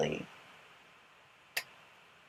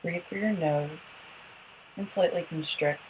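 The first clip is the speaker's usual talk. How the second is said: guided yoga instruction; 4 dB quieter, faster than usual. Acted. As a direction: guide the breathing gently, unhurried.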